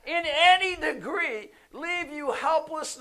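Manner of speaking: loud, high, drawn-out preaching shout; emphatic promise. Acted. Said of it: "he's emphatically saying that".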